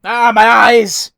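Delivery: slow, loud cry of pain; mock pained cry. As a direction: cry out as if blinded. Blinded by bright flash.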